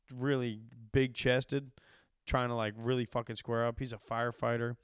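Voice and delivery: ordinary talk, nothing marked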